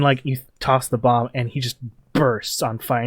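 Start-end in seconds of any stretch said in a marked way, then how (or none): none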